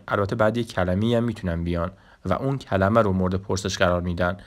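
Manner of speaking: ordinary talk, nothing marked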